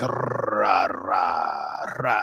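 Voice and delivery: "Rah" with a heavy trilled R; rolled R's. Retold or imitated. Showing friends Nosferatu's accent, "Rah" with trilled R. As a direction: roll the R in "Rah" hard, like a Spanish R. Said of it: "he rolls his R's like Spanish R's".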